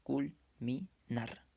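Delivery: very slow "Culminar", broken into cul, mi, nar; syllable by syllable. Shown as a demonstration. Teacher separating the syllables for students, "Culminar" slowly, syllable by syllable. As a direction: split "Culminar" into three separate syllables with pauses. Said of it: "pronúncialo de corrido nada más".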